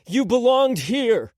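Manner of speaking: loud, high, slow call; shouted across the driveway. A narrator's reading. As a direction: call it out after her. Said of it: "he called out".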